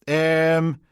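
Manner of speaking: high, loud, tumbling Peterhead apologies; flustered Peterhead PC. Acted. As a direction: backpedal fast in broad Peterhead. PC hesitating.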